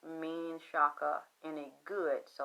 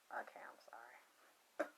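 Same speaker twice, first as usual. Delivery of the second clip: slow, whispered sorry; very soft, low whispered aside; hushed apology; 8 semitones lower, 22 dB quieter, slower than usual. Real, not acted. Aside apology after a pause.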